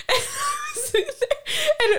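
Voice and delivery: high, slow, laughing through the words. Real, not acted. Friend laughing at her own panic story to her cohost. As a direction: laugh while trying to keep telling it.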